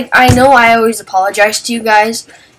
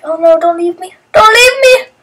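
whiny plea; 9 semitones higher than usual. Retold or imitated. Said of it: "And Matthew's like".

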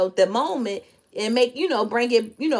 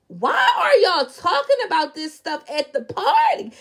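incredulous; 8 semitones higher than usual. Retold or imitated. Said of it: "And I'm like".

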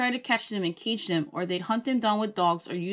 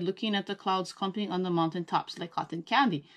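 ordinary delivery, nothing marked

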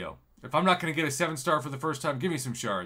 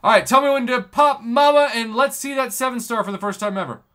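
high, loud, eager call to Mama; hyped up; 10 semitones higher, 10 dB louder than usual. Real, not acted. Asking Mama to tap for a seven star, hyped and high. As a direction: pump it up for the big pull.

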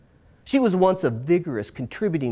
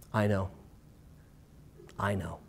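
quiet, deflated I know, fading softer; a mother giving up hope, barely above a breath; resigned; 7 semitones lower, 12 dB quieter than usual. Retold or imitated. Mom admits the remedy failed, quiet and resigned.

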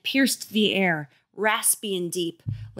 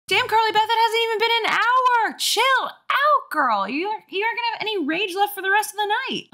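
sassy scolding. Real, not acted. Reader scolding character high.

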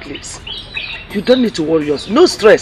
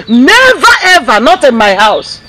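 indignant outburst; 5 semitones higher, 5 dB louder than usual. Acted. Mother rants about the girl.

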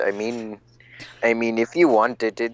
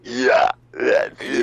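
put-on Phoenix voice; 2 semitones higher, 6 dB louder, slower than usual. Trying out Phoenix's voice.

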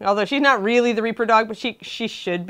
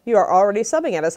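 normal talking voice

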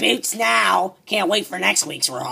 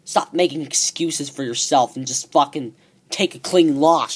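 ordinary talk, nothing marked

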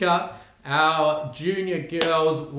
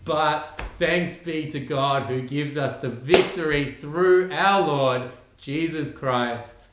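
reciting scripture; 3 semitones higher, 3 dB louder, slower than usual. Acted.

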